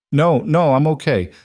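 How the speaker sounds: trying to sound fine but mildly shaken; shaking voice. A narrator's reading. Woman reassures her bodyguard after a shootout, mildly shaken. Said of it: "Charlotte's voice shook".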